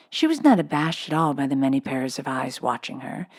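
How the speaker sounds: plain reading voice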